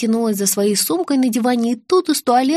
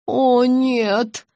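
drawn-out moan of dismay; stricken, moaned protest; groaning. A narrator's reading. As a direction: groan it in dread. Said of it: "простонала Вера".

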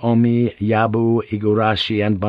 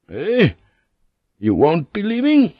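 much higher, a sudden startled question; startled surprise; 9 semitones higher than usual. Acted. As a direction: caught off guard, it jumps out high. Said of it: "totally unprepared for this".

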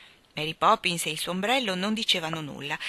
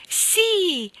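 high, loud yes; excited shout; 13 semitones higher, 6 dB louder than usual. A narrator's reading. Children joining the whirling dance, excited shout. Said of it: "gridarono Jane e Michael".

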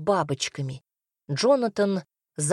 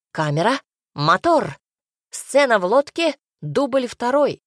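raised film director's call; calling a take; 5 semitones higher, 4 dB louder than usual. Acted. Dwarf director starts the boat scene, calling out. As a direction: announce it loudly to the crew.

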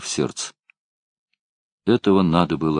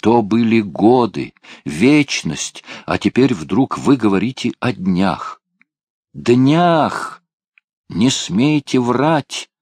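выше и громче, «Днях!» срывается в крик; нарастающий крик, в конце медленно; отчаянный крик; 6 semitones higher, 4 dB louder, slower than usual. Acted. Лебенталь в отчаянии кричит. (отчаянный, нарастающий крик)

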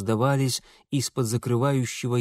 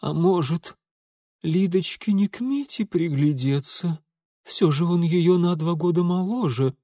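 higher, faint-hearted mother's suggestion; feeble dying voice; 5 semitones higher than usual. A narrator's reading. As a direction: suggest it weakly to your husband. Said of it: "умирающим голосом пролепетала Полина Марковна".

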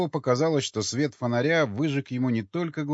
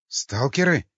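startled, slightly raised question; frightened; 3 semitones higher, 4 dB louder, slower than usual. A narrator's reading. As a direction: ask it with a touch of fright. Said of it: "испуганно произнёс Николай". (испуганный вопрос)

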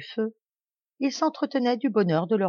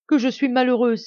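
anguished exclamation, louder; cry out; 5 semitones higher, 5 dB louder than usual. Acted. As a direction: wail it out in despair, a touch louder. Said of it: "s'écria-t-elle".

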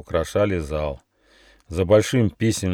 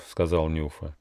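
ordinary delivery, nothing marked

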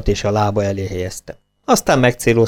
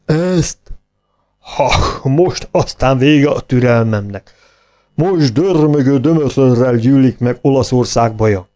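mock fury; 4 semitones higher, 3 dB louder, slower than usual. A narrator's reading. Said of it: "kiáltotta".

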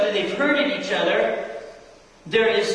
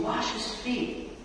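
voice of Jesus; 9 dB quieter, slower than usual. Retold or imitated. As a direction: breathe it softly as the Lord's command. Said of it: "finally just Jesus saying".

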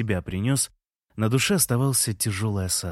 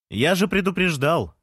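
high-pitched protest shout; calling out; 7 semitones higher than usual. A narrator's reading. Narrator defending himself to Lea, calling out high. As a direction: call up to her in self-defence, pitched up. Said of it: "крикнул я Лее".

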